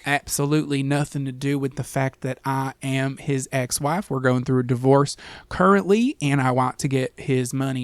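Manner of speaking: put-on Southern twang; Southern accent. Podcaster voicing a scheming ex-wife going through divorce, Southern accent. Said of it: "giving them that accent".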